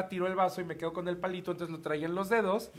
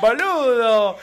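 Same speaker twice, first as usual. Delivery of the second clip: loud hammed-up Argentine boludo; mock Argentine accent; 3 semitones higher, 12 dB louder, much slower than usual. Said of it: "Cómo hablan los argentinos".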